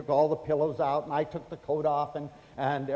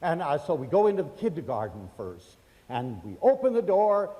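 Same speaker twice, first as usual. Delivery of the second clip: normal talking voice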